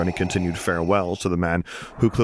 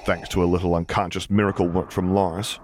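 ordinary delivery

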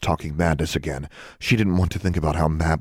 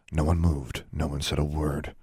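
ordinary delivery

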